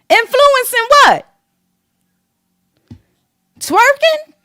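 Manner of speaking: high, slow scornful question; high mocking question. Real, not acted. Mocking the Instagram influencers with a high scornful question. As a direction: sneer it up high.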